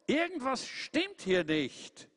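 hohe, gedehnte Truthahnstimme; Truthahn Tom. Acted. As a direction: jung und besorgt, hoch gesprochen. Truthahn Tom spricht hoch. (hohe Stimme des Truthahns Tom)

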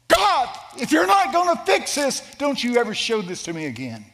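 high, loud anguished cry; screaming at God. Retold or imitated. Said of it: "I screamed at God".

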